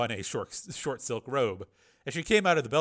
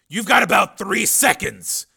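loud, slow, raised threat; menacing threat; 9 semitones higher, 12 dB louder, slower than usual. Acted. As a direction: give a forceful final warning. Threatening a captive at gunpoint.

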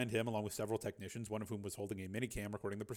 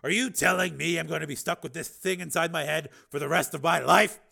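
sharply louder and higher, stunned disbelief; stunned outburst; 6 semitones higher, 9 dB louder than usual. A narrator's reading. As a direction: blurt it out in shock, rising to a near shout.

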